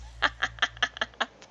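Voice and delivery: quick amused laugh; short laugh. Real, not acted. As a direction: laugh at your own joke. Laugh after aside.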